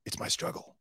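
whispered, quick conspiratorial mock Alec; mock scheming whisper. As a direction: hush it like a secret plan, fast. Imitating Alec's private documentary ambitions.

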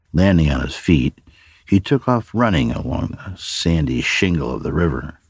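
plain reading voice

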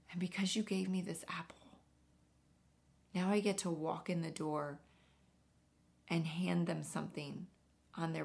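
soft, hushed, near-whispered tearful quote; mild retold crying. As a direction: retell the tired mother's tears gently and quietly. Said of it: "the woman started crying".

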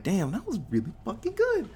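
high, put-on voice of approval; impressed reaction. Viewer reacting to the film's ending, high impressed voice.

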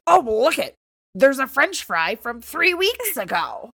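loud, high, drawn-out mock discovery; mimicking husband. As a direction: exaggerate the find, stretching the words out. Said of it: "he was like".

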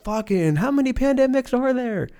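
high, exasperated put-on voice; exasperated consumer. Comedian voicing a fed-up person, high exasperated whine. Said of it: "and you're like".

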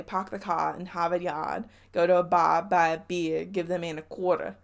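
Boston-style Northern accent, R's dropped. Shown as a demonstration. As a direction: say it in a Northern accent, "pahk the cah". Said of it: "say it with a Northern accent".

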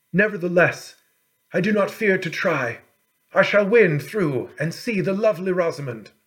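brave prince. A narrator's reading. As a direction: declare it with youthful resolve. Young prince vows to try.

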